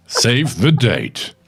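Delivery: slow, drawn-out trailer announcer; movie trailer voice. Acted. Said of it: "movie voice over".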